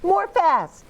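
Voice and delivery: put-on high eager toddler shout; high excited child call; child cheering. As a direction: urge mama on like a little kid. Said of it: "said Perry".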